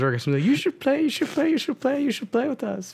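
high pleading nagging voice; whiny coworker voice. Retold or imitated. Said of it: "She says".

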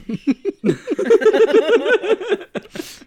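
loud high burst of laughter; cracking up loudly. Real, not acted. Laughing hard at the joke, loud.

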